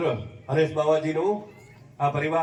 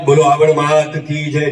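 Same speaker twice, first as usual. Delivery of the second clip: loud devotional call; 10 dB louder than usual. Real, not acted. Devotional slogan call to crowd.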